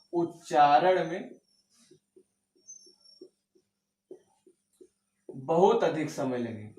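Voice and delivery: slow stretched words, each one held long; drawn out slowly. Shown as a demonstration. Teacher to students, showing extra length by stretching slowly. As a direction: drag the phrase out to show a long vowel. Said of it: "इसमें बहुत अधिक समय लगता है".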